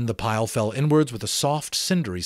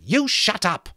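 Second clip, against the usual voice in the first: put-on higher, sharp boy's rebuke; fierce; 12 semitones higher, 5 dB louder than usual. Jack silencing Piggy in front of everyone, fierce. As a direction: cut him off hard. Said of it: "Jack turned fiercely".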